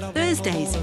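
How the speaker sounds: usual speaking voice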